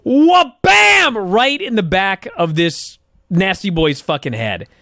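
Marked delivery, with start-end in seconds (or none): 0.0-1.2 loud, high-pitched explosive WHA-BAM; imitated impact. Host acting out the chair shot, loud explosive impact. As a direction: slam the word like a chair hit. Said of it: "this head tranger goes".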